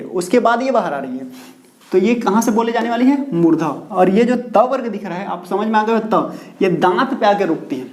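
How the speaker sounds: normal talking voice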